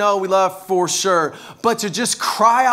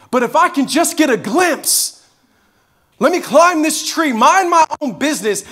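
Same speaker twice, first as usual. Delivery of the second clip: higher, louder, yearning inner voice of Zacchaeus; pleading to himself, much higher and louder; voicing Zacchaeus longing; 4 semitones higher, 5 dB louder than usual.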